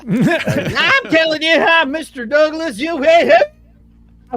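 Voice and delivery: slow, twangy country salesman pitch; Mister Haney voice. Acted. Hosts tease [name], he answers as Mister Haney in a drawl. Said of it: "sounding like Mister Haney from Green Acres".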